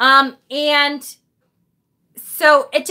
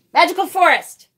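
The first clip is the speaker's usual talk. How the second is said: ordinary delivery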